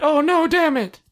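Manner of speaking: very high put-on ghost voice; mock dismay. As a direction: cry out high in comic dismay.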